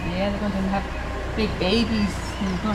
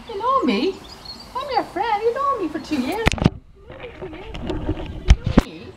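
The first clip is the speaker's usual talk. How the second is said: 1 coaxing; 10 semitones higher than usual. Real, not acted. Pleading with aggressive stallion.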